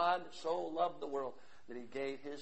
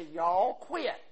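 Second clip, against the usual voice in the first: put-on Southern US accent; 5 semitones higher, 10 dB louder than usual. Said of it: "in the southeastern U S, they'd say".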